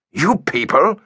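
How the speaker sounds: incredulous at being lumped in. A narrator's reading. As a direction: throw his words back in disbelief. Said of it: "repeated incredulously".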